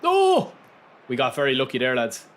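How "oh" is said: sudden high "Oh!"; surprised shout. Real, not acted. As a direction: yelp in shock. Sudden reaction to a chance.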